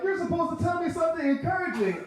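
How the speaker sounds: put-on high pleading little-boy voice; whiny kid voice. Crying little cousin demanding comfort, high whine. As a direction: beg like a crying child.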